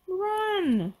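high, urgent cry; sudden high-pitched warning; Fred. Acted. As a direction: call it out long and high. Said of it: "Fred said".

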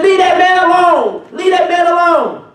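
high, slow shouted plea; a child yelling down the street; little girl calling out. Retold or imitated. Little girl shouting at the gang to stop.

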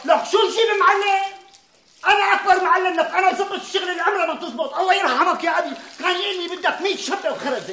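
worked-up self-defence; indignant boasting. Acted. Insists he is best plumber.

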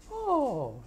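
high OH sliding down like a singer; octave glide. Retold or imitated. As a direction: swoop from high pitch on one long OH. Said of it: "glides over an octave like a singer".

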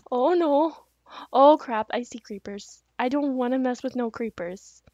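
ordinary talk, nothing marked

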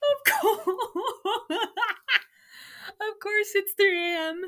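high, loud words through laughter; laughing. Real, not acted. Mocking her teenage writing while laughing loudly.

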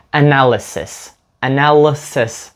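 slow "Analysis" as "a-NAL-sis"; reduced ANALYSIS. Shown as a demonstration. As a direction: draw out "Analysis", dropping the vowel after l. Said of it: "між L і S ніби нічого немає".

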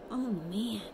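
ordinary delivery

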